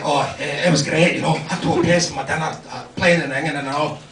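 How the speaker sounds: Dundee patois. Acted. Son telling English mother about school, low Dundee patois. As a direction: answer in broad Dundee dialect. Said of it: "picked up a little bit of the local Dundee patois".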